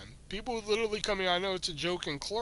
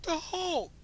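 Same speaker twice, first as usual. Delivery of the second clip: higher still, strained pleading cry; desperate near tears; 12 semitones higher than usual. Retold or imitated. Clerk cracking under customers' vague request, high strained plea. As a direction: beg them to see it's obvious. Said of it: "I'm almost in fucking tears".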